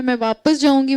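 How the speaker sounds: normal talking voice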